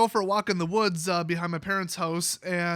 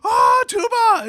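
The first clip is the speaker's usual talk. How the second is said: cheerful coo that bursts into a much higher, much louder scream; scared toddler shriek; 12 dB louder, slower than usual. Retold or imitated. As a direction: imitate a terrified little girl shrieking at a tuba. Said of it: "she was like".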